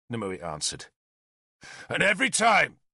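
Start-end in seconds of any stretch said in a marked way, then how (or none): 1.9-2.8 raised, high, public voice; speaking loudly. A narrator's reading. Arthur addressing everyone by the gallows, speaking loudly. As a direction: project it so all can hear. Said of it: "Arthur spoke loudly".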